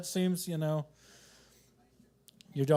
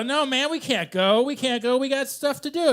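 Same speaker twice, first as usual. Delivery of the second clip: loud, high-pitched objection; mock protest; 8 semitones higher, 8 dB louder than usual. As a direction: argue back loudly like an agitated buddy. Acted argument between stoned wise men.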